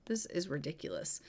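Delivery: low, whispery exasperation; hushed, fed-up aside after an argument; exasperated under-breath remark. Acted. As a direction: grumble it to yourself, barely voiced. Husband alone after the argument.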